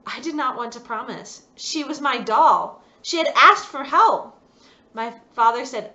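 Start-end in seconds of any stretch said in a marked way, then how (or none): 1.6-4.4 upset insistence. A narrator's reading. Girl's upset inner protest.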